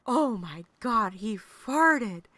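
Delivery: loud, slow, startled Oh my God; shocked cry. Retold or imitated. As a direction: blurt it out in loud disbelief. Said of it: "looked over at each other and said".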